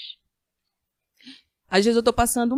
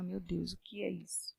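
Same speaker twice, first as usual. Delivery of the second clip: stunned hush; 3 semitones lower, 15 dB quieter than usual. Retold or imitated. Doctor reveals atrophy.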